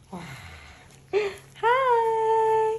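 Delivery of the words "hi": loud, high, drawn-out hi; singsong high-pitched greeting; high playful greeting. Real, not acted. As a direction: call out a long high hello. Playful greeting to baby or viewers.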